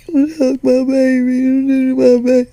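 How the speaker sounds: high, slow, loud cooing; doting mom. Retold or imitated. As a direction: stretch it out like a mother aching to hug her child.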